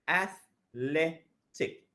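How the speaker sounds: slow, drawn-out athletic, syllable by syllable; slow syllable demo. Shown as a demonstration. Teacher stretching athletic for students to count syllables. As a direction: spell out athletic slowly for counting. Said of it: "Three syllables".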